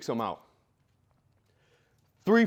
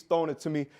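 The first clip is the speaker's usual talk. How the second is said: ordinary talk, nothing marked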